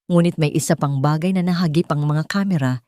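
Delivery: plain reading voice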